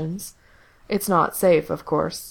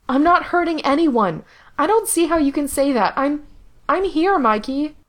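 much higher, louder anguished protest; Gerard distressed; 9 semitones higher, 4 dB louder than usual. Acted. Gerard insists to Mikey he is still here, higher and pained. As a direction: insist desperately, voice cracking upward.

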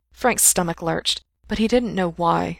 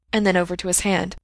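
usual speaking voice

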